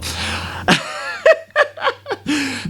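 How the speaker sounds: squealing high burst of laughter. Real, not acted. Laughing at the book story's absurdity. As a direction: let out a shrill burst of laughter.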